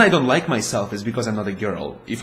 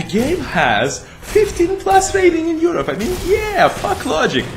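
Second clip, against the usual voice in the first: much higher, slow mock disbelief; incredulous squeak; 4 dB louder, slower than usual. Real, not acted. Ranting about Europe's age rating, incredulous. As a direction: sound outraged at the rating.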